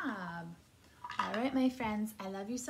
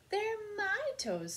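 high, drawn-out penguin squawk; 5 dB louder, slower than usual. A narrator's reading. Penguin answering a guessing question for little listeners, squawking. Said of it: "squawked the penguin".

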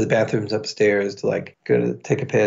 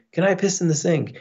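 higher-pitched imitation of his own griping; mocking self-quote; 7 semitones higher than usual. Drunk man overheard by hidden bouncer, mock griping. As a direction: parody yourself in a raised pitch, heading into laughter. Mimicking himself overheard, about to laugh.

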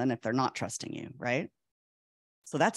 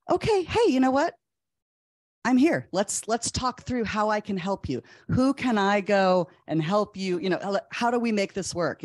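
a professor eagerly offering help, pitched up; retold eager offer of help; 5 semitones higher than usual. Professor voiced offering student help.

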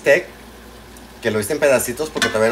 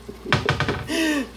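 high laugh; 3 dB quieter than usual. Real, not acted. Laughs about having servants.

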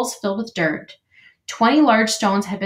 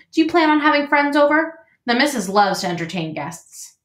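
cheery beaver, lifted higher; chatty, welcoming beaver; friendly beaver voice; 6 semitones higher, 3 dB louder than usual. Acted.

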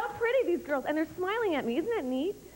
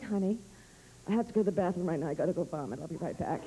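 sexy low voice; 10 semitones lower than usual. Shown as a demonstration. Comic showing any line sounds sexy when said low, deep. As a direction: say crude words in a deep seductive voice. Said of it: "it turns men on".

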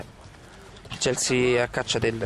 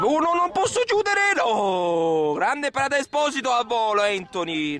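excited; 10 semitones higher, 3 dB louder, slower than usual. Acted. Commentator surprised by late save.

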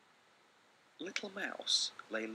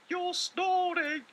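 much higher, louder, slow little voice; calling little mouse; 11 dB louder, much slower than usual. Acted. Little Mouse calling his brother at night, high squeaky call. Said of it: "he called".

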